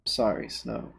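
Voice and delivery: ordinary talk, nothing marked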